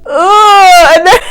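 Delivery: shrieking real laughter; loud laughing. Real, not acted. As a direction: burst out laughing, loud and high. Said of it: "Sorry, that was so loud".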